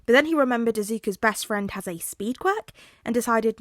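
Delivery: plain reading voice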